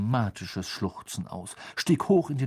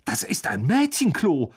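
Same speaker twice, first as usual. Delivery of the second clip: higher, scandalised exclamation; appalled; 5 semitones higher, 4 dB louder than usual. A narrator's reading. Older brother catches boys leaving girls' toilet, appalled raised voice. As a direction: say it aghast, as if shocked. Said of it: "sagte er entsetzt".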